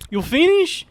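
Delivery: lightly voiced woman asking; a hint of an accented woman's question; imitating the woman. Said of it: "And then she's like".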